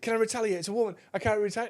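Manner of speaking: high-pitched, put-upon appeal; mock indignant protest. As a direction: imitate him appealing to the room. Retelling protest after drink thrown.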